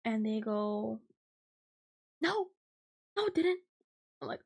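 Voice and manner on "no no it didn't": a lightly voiced, high, insistent customer; a hint of a fussy old woman; mock old lady. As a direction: squeak out her stubborn denial.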